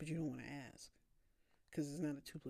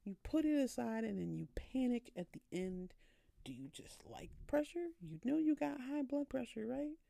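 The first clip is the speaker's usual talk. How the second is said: higher, scolding inner voice; nagging inner critic calling out [name]; scolding myself; 7 semitones higher than usual. Retold or imitated. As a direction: berate yourself like a nagging parent. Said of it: "Beating myself up".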